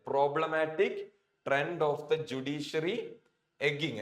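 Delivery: slow, careful reading of the text line; slow read-aloud. Shown as a demonstration. Teacher reads the sentence for students, slowly. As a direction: lay each word down slowly for the learners.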